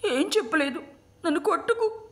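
put-on very high, tearful child's plea; crying. A narrator's reading. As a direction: plead like a sobbing boy, pitched far up. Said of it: "అంటూ ఏడ్చాను".

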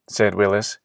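plain reading voice

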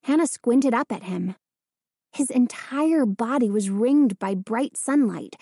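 usual speaking voice

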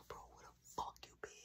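fast, hushed whisper; urgent whisper. Retold or imitated. To the runaway brother at night, urgent whisper. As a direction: hiss it quick so mom won't hear. Sneaking, house asleep.

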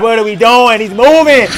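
usual speaking voice

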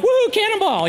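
high, loud cannonball whoop; gleeful whoop. Acted. Comic showing how he jumps into awkward talk, high gleeful whoop. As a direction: holler it like leaping into a pool. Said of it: "I'm more like".

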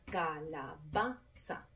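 "calabaza" in separate syllables, ca-la-BA-za; stress on BA. Shown as a demonstration. Teacher showing the tonic syllable, "calabaza" split with BA stressed. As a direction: break up "calabaza" and lean on BA. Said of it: "la sílaba tónica".

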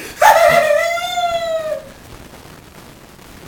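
very high shrieking laugh-cry; wild crying laugh. Real, not acted. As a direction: break into a howling laugh. Laughing wildly at mistake.

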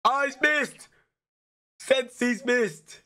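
high and loud, suddenly thrilled; the gamer sees the keeper win it for him; excited. Real, not acted. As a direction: squeal it as the rival misses. Opponent misses a penalty.

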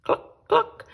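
high clucking hen. Acted. Hen clucking in the yard.

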